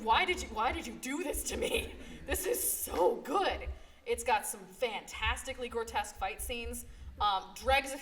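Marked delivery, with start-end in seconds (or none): 0.0-3.7 mock outrage laughing. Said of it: "I was so angry".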